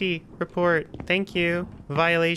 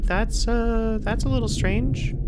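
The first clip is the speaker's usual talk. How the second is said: ordinary delivery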